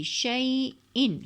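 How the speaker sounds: شیئن drawn out slowly with a hamza catch; slow recitation. Shown as a demonstration. Teacher reciting شیئن to show the hamza. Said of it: "ان کی آواز آ رہی".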